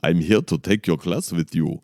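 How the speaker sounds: badly faked German accent; comic made-up German, thick accent. Shown as a demonstration. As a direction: put on a clumsy mock German. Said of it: "I can't do the German".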